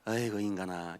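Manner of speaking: low, very soft grumble; weary exasperation. Acted. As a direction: mutter in mock despair at your spouse. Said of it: "그땐 무슨 생각 드십니까?". (낮게 한탄하듯 푸념)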